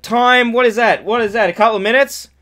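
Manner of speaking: high, loud disbelief; mock outrage. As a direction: act shocked at the time. Mock outrage at short countdown.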